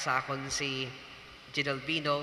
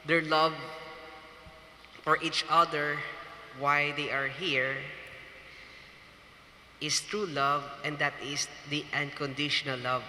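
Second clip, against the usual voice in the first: preaching. Acted. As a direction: preach it to the congregation, measured and solemn. Wedding homily after casual chat.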